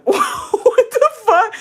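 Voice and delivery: high, slow, laughing what the fuck; laughing outrage. Real, not acted. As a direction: squeal it out through a laugh. Insulted by stranger, laughing outrage.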